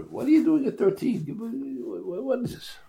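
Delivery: high-pitched pretend bafflement; hammed-up disbelief at a youngster; mock incredulous. Mock objection to thirteen-year-old speaker.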